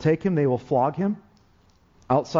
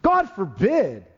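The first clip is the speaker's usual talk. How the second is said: drawn-out outraged protest; crowd yelling out; 7 semitones higher, slower than usual. Retold or imitated. Preacher mimicking the shocked crowd's slow, raised outcry. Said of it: "They started all yelling out".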